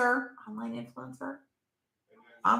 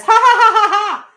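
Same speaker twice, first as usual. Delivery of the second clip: much higher, loud cackling mock laugh; mother's laugh; 13 semitones higher, 13 dB louder than usual. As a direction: ham up mom laughing at her joke. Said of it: "my mother ... says".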